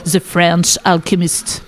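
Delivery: band name said slowly in her best English accent; French speaker's careful English accent. Real, not acted. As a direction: reach for an English accent on the name, self-mocking. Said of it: "avec mon magnifique accent anglais".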